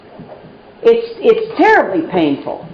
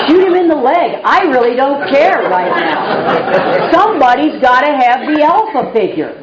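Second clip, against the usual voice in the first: laughing, higher and sped up with disbelief; laughing retold outburst; 6 semitones higher than usual. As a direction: tell the cop off half laughing. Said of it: "And I said".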